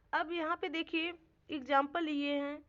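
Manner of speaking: ordinary talk, nothing marked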